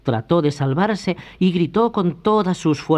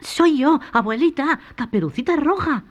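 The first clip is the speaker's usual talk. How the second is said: put-on much higher, bright girl's voice; little girl; 13 semitones higher than usual. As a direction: sing out like a happy child. Caperucita llega contenta. (voz aguda de niña)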